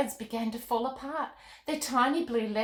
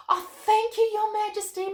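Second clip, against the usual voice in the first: much higher and louder, bursting with joy; cheering; 12 semitones higher, 7 dB louder than usual. A narrator's reading. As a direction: cheer it while jumping up and down. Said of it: "Molly cheered".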